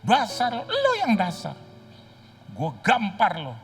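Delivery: high, loud furious yell; mock father-son fight, shouted; angry shouting match. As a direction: scream the insult back.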